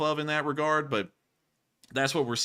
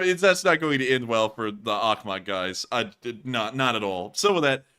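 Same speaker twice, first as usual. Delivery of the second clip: ordinary talk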